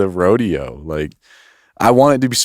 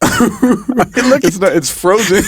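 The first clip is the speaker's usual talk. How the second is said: high voice through real laughter; giggling, pitch jumping up; laughing hard; 12 semitones higher, much slower than usual. Real, not acted. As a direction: talk while cracking up. Laughing at the frozen picture.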